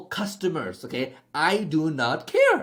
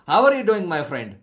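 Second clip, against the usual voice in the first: put-on Indian accent; 2 semitones higher, 7 dB louder than usual. Salesman jokingly opening a call to an Indian-looking client, Indian accent. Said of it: "have to listen to his accent".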